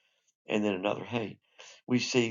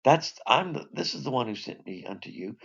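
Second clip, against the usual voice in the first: normal talking voice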